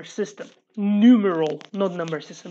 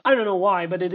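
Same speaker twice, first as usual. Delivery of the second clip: usual speaking voice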